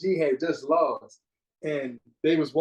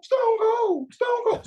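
excited cry; 4 dB louder, much slower than usual. Real, not acted. Excited cry for favorite wrestler.

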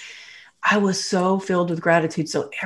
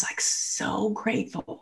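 whispered, overcome by gratitude; choked whisper; 4 semitones higher, 11 dB quieter, slower than usual. Real, not acted. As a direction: breath-only whisper as the voice gives out. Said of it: "I'm getting choked up".

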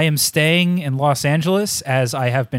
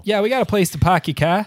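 put-on thick Boston accent, higher; 5 semitones higher than usual. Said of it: "a thick Boston accent".

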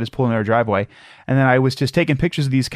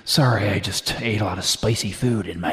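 creepy stranger voice; 5 semitones lower than usual. Pretend. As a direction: play a creepy stranger with a low voice. Acting creepy dog-park stranger.

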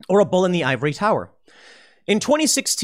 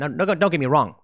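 ordinary talk

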